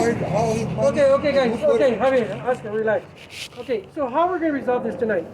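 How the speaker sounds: loud, firm call to settle down; an officer loudly calling two arguing brothers to relax; loud calming command. Real, not acted. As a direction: raise your voice to stop the fight, then steer it.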